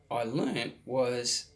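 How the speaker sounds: ordinary talk, nothing marked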